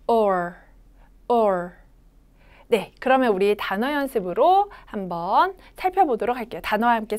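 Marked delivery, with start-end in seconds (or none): 0.1-1.7 or said slowly, a short 우 with the tongue curled back; 천천히 시범 발음 or; slow model or. Shown as a demonstration. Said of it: "한 번 더".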